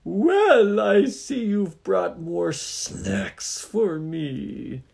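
high, loud, slow monster gloat; Oni voice. Acted. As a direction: gloat over the snacks slowly. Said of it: "that's the Oni's voice".

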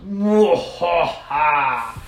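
slow, loud villain cackle; drawn-out mock evil laugh. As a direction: boom out a hammy mwahaha.

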